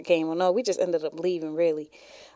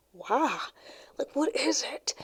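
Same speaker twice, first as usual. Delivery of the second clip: high, hushed disbelief; baffled; 7 semitones higher, 7 dB quieter, slower than usual. Retold or imitated. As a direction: ask it in soft, high-pitched bewilderment. Retells her baffled reaction.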